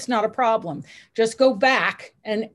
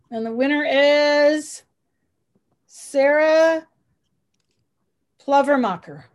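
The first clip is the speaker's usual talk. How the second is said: hyped giveaway announcement; 5 semitones higher, 6 dB louder, slower than usual. Real, not acted. Drum roll, announcing contest winner.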